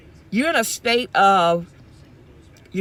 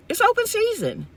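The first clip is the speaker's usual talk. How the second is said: much higher, an exasperated cry; high-pitched emphatic repeat. Real, not acted. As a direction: throw the repeat up high in disbelief.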